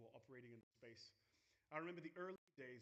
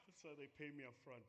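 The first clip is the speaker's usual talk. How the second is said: ordinary talk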